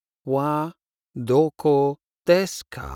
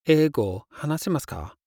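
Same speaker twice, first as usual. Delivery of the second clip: fast; 3 semitones higher, much faster than usual. Shown as a demonstration. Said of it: "Do you speak English?".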